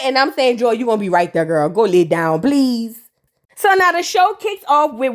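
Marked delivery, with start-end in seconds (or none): 0.6-3.0 low, calm mock reassurance; soothing reply. Narrator calming Joy down, low and gentle. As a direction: settle her down gently.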